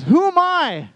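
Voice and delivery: high, loud, drawn-out question; anguished cry. Retold or imitated. All these voices in Moses' head, anguished cry. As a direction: cry out in self-doubt.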